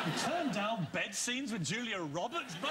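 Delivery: high-pitched, disbelieving outburst; incredulous. Real, not acted. Host ribs actor over rejected love scenes, incredulous. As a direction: exclaim in amused disbelief.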